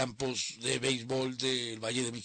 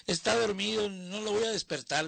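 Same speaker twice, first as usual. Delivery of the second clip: higher, louder mother's voice; compadre's mother; 10 semitones higher, 3 dB louder than usual. Retold or imitated. Mother turning away the caller at the door, higher and louder. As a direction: brush the caller off as the protective mother. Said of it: "decía la mamá".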